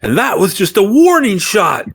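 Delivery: high-pitched, blustering warning to armed foes; intimidating threat. Acted. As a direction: put on a big, high voice to intimidate. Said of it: "get real big with the knife and the pistol".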